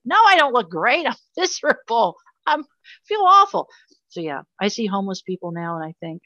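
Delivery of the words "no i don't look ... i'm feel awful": higher and louder, half laughing in protest; bitter laughing protest, much higher and louder; laughing exasperation. Real, not acted. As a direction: laugh through the frustration, pitch climbing. Protesting people's compliments, laughing.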